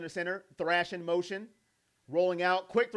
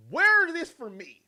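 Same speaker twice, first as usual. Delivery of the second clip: higher, louder indignant cry; mock jealous quarterback; 7 semitones higher, 5 dB louder than usual. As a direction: complain you never get this. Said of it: "Yo, if I'm Flacco, I'm mad".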